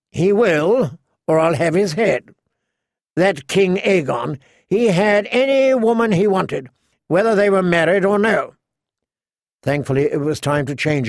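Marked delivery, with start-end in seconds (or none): 0.1-8.6 put-on higher, imperious boy-king voice; entitled, threatening young royal; Joffrey. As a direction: lay down a royal command like a spoilt youth.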